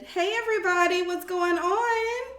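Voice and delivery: high, bright customer service greeting; work voice. Real, not acted. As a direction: greet them like a friendly clerk. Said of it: "I got my work voice on today".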